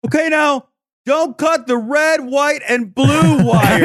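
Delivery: slow, high, careful bomb-defuser voice; tense bomb diver. Pretend. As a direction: talk the diver through it slowly.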